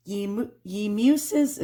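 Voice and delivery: slow, put-on New York accent on an old poem. Shown as a demonstration. Vlogger reading a Victorian album in a New York accent. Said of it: "there I go with my New York accent".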